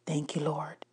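soft, hushed gratitude; bow and murmur it; barely-there humble thank you; quiet thanks. A narrator's reading. Zebra bowing in thanks.

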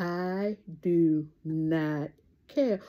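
drawn out with a light rise on care; slow deliberate catchphrase. Real, not acted. Vlogger to viewers, slow firm catchphrase. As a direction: take your time on every word.

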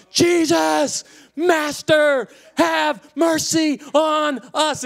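high, drawn-out shouted plea; loud crying out. Acted. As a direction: yell it out like begging through a megaphone. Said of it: "yelled out in a loud voice".

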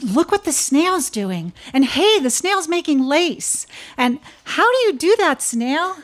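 high, loud and eager; little bugs squealing with wonder; thrilled chatter of tiny bugs; excited bugs. Acted. Bugs marvel at the snail.

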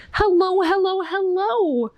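high, singsong hello; eager greeting. A narrator's reading. Norma Jean greeting Amy at her pool, high and eager. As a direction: call out a lively greeting in a high voice.

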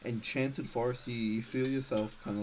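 normal talking voice

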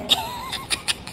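a high breathy burst of laughter; real laugh. Real, not acted. Laughing at mother's cursing.

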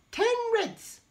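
put-on squeaky high little girl. A narrator's reading. Teacher reads the girl in red's answer, high and squeaky. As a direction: pipe it up high as a small girl. Girl's line in read story.

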